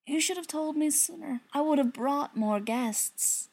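a light put-on voice for him; character voice. Character speaking, far timbre.

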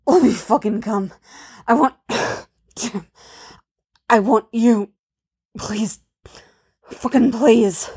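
broken, forceful pleading with pauses; rough begging. A narrator's reading. He begs her between curses, roughly pleading. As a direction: plead in ragged bursts, pushing the words out. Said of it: "even his begging is rough".

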